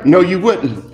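low, firm correcting voice; low voice of the Spirit; stern inner voice. Acted. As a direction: shut him down calmly from within. Holy Spirit answers him.

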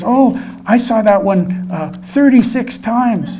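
put-on a proud child drawing out the count; bragging kid. Kid boasting to the filmmaker how often he watched, high, slow and laughing.

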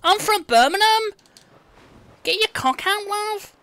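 hammed-up Brummie woman, very high; high-pitched mock Brummie come-on; Birmingham accent. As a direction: squawk it like a caricature Birmingham girl. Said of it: "as soon as she started talking".

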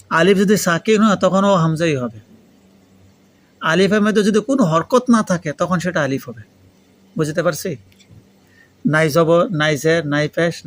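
normal talking voice

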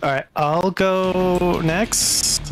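usual speaking voice

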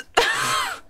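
very high laughing shriek; shocked squeal of laughter. Real, not acted. Streamer reads chat message, shocked squealing laugh.

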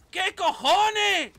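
loud, high, drawn-out yell; real startled holler; shocked yell. Real, not acted. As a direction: shout it in disbelief, stretching the last word. Sudden attack in game.

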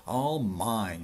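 loud, triumphant claim; exultant cry. Acted. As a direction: cry it out proudly. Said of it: "Yertle cried".

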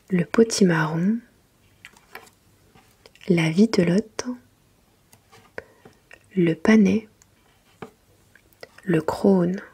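ordinary delivery

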